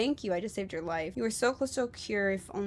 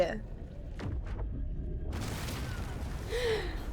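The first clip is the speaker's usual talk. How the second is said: sharp gasp; 13 semitones higher, 5 dB quieter than usual. Real, not acted. Sudden turn in game.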